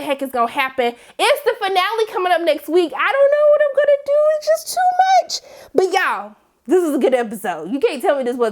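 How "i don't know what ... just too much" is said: high wailing despair, slowing on "too much"; dramatic despair. Real, not acted. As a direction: wail it out in mock anguish. Despairing over the finale.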